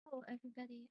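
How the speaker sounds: barely audible, far from the mic; faint, very soft greeting; quiet voice. Real, not acted. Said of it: "You're really quiet".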